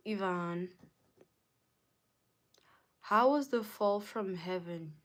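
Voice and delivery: ordinary talk, nothing marked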